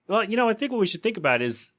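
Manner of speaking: put-on offhand suggestion to the kids; lightly voiced Peter; mock Peter. Peter suggesting abandoning mom.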